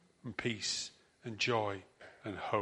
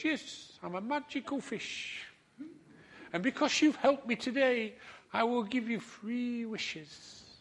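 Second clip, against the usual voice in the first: a small magical fish granting wishes; high little fish voice, unhurried; 11 semitones higher than usual. Acted. Magical fish offers three wishes.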